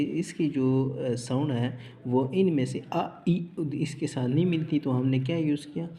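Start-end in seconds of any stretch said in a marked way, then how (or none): none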